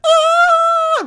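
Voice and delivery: high, loud shriek of fright; piercing startled scream; sudden scream. Retold or imitated. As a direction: shriek like you just saw a bug. Girl screams at something.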